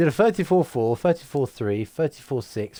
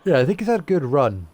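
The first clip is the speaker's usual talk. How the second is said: talking through a yawn; sleepy, mid-yawn words; 4 dB louder than usual. Real, not acted. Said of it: "Stop yawning".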